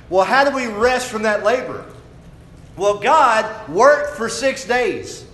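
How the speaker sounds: loud, high-pitched sermon delivery; full-force preaching. Real, not acted. Preacher telling congregation about God's rest from creation, full-force preaching. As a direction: preach it out, building in pitch and volume.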